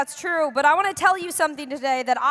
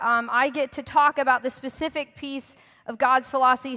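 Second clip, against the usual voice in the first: ordinary delivery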